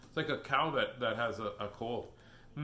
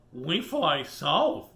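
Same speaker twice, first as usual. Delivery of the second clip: geese voice; 3 semitones higher, 9 dB louder, much slower than usual. Acted. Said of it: "says the geese".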